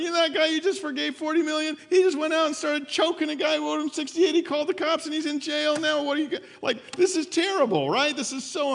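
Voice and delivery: excited tattling. Acted. Servants telling their master what happened, high and fast. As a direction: rush the outraged news to the boss.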